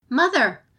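shouted call across a field. A narrator's reading. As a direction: call out loudly to your mother. Samurai calls across the field.

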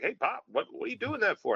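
a hint of a four-year-old asking dad; little kid voice. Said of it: "and I'm like".

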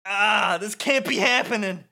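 high, loud hammed-up dismay; Wakka. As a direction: cry out in disbelief.

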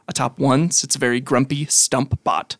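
ordinary delivery

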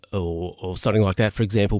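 usual speaking voice

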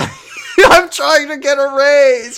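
high, loud, drawn-out wail; over-the-top frantic pleading, stretched out; mock-desperate yelling. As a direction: wail it in hammed-up panic. Acting Josh yelling at Amanda.

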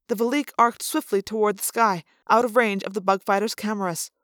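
usual speaking voice

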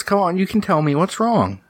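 high, gentle mother voice; coaxing mom. Acted. Mom hugging her crying child, high coaxing voice. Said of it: "And she says".

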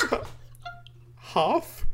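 laughing through the word half; cracking up while repeating half?; laughing disbelief. Retold or imitated. Connie, handed a tiny chunk, laughing disbelief.

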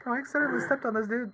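offhand chill rock creature; casual alien voice. Retold or imitated. Quoting Korg, friends laughing.

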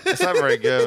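high odd voice with the jaw pushed forward. Shown as a demonstration. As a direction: push the jaw out and talk high. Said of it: "I talk like this for a little while".

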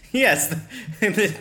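high laughing voice. Real, not acted. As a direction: laugh through the words.

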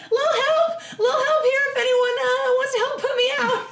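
high-pitched, strained cry for help; mock scream. Burning gnome yelling for anyone to put her out, mock scream. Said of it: "I guess I could scream".

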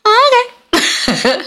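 very high drawn-out okay; fake-agreeable okay; sugary okay. Pretending to accept a coworker's way, very high. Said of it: "mock sweet okay".

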